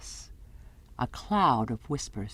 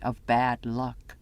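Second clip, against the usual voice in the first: ordinary delivery, nothing marked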